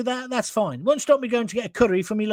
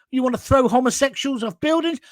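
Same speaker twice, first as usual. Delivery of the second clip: ordinary talk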